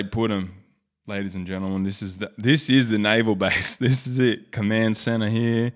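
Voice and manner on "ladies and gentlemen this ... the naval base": ordinary talk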